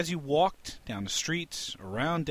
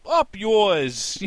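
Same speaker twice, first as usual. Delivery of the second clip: loud, high comic rebuff; mock hostile retort; 9 semitones higher, 9 dB louder than usual. New York goomba answering cheery neighbours, loud hostile retort. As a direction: throw it back loudly at the friendly greeter. Movie character's rude reply to greeting.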